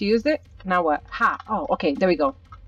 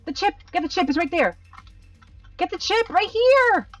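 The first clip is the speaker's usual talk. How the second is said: urgent calling out; 10 semitones higher than usual. Real, not acted. Urging teammate to get chip.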